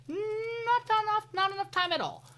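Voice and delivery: high, slow stress on not enough; slow emphatic not enough. Real, not acted. Emphatic answer to own question.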